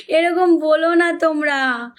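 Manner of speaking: slow, stretched-out pleading; drawn-out plea. Real, not acted. Coaxing her live viewers slowly not to talk like that. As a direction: draw it out, coaxing them to stop.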